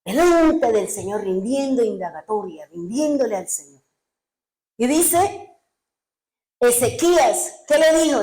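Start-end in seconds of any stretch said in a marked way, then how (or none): none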